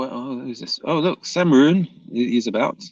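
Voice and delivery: ordinary talk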